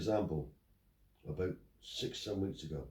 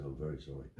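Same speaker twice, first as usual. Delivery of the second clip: softer, lower mock-contrite apology; mock sheepish apology; 7 semitones lower, 9 dB quieter than usual. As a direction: mimic the bailiff's meek climbdown. Retold bailiff apology.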